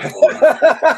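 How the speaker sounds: loud, high teasing jab; teasing shout. Acted. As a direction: hit the punchline hard. Teasing punchline.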